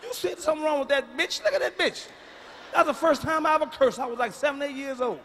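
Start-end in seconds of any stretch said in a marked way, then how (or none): 0.0-2.1 put-on high-pitched child in disbelief; alarmed child. Child to mom about staring auntie, high alarmed voice. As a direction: point out the strangeness in a high voice.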